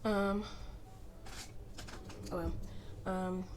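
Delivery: talking with dry chapped lips. Real, not acted. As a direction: speak through cracked dry lips. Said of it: "my lips are chappity".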